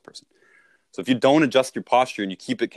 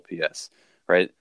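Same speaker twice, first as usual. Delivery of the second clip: usual speaking voice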